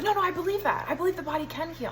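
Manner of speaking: insistent protest. Retold or imitated. Doctor assures doubting patient he believes, imitated insistent protest. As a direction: protest quickly, imitating someone on the defensive.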